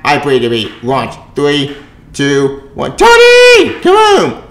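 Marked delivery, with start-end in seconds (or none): 3.0-4.5 much higher, loud yell after someone leaving; calling out shout. Acted. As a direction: shout after him as he flies off. Cap calling after Tony leaving.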